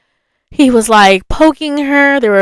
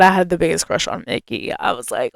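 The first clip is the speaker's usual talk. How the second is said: usual speaking voice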